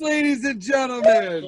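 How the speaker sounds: a loud ringside-style call; shouted announcer call. Acted. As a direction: belt it out like the MC's big finish. Host closing the introduction.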